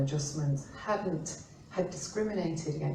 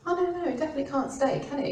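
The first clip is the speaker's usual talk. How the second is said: fast, high-pitched mock insistence; insistent boss; 8 semitones higher, 4 dB louder, faster than usual. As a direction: rattle it off, pitched up, like a stubborn boss. Client insists, retold.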